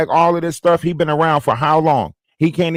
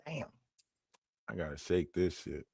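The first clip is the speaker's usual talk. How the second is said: much softer, low mutter to himself; muttered aside; 8 semitones lower, 21 dB quieter than usual. Real, not acted. Sick streamer muttering about being unwell. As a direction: grumble it half to yourself.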